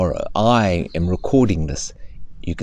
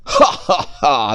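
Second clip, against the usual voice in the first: mock laugh; 6 semitones higher, 4 dB louder, slower than usual. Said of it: "the cheetah walked off".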